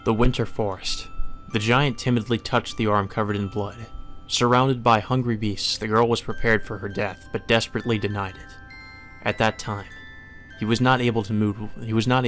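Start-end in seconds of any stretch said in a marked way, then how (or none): none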